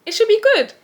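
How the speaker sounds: sing-song sarcasm up high; mock hopeful. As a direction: chirp it up sarcastically.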